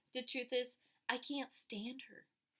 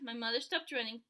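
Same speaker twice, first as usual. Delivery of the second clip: ordinary delivery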